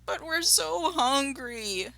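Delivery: high, drawn-out whine of hungry cubs; plaintive high child-fox voice; crying out. A narrator's reading. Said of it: "they cried".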